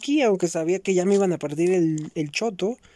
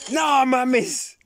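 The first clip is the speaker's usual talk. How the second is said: sudden high yelp of surprise; startled shout; 7 semitones higher than usual. Real, not acted. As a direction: jump at a scare mid-game. Sudden startle during gameplay. (grito de sorpresa repentino)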